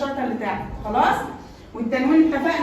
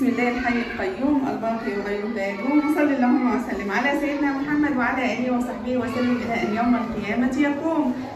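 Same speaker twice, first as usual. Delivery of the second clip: lower, measured religious invocation; opening prayer; 4 semitones lower than usual. Acted. Teacher opening the lesson with a pious invocation. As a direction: recite the blessing calmly before class.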